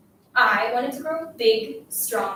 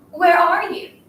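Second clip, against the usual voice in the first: raised, louder quoted call; shouting; 6 semitones higher, 7 dB louder, slower than usual. Acted. Girl quoting how her classmate yelled at home, shouting. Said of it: "You were shouting".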